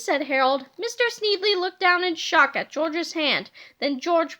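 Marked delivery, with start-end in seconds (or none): none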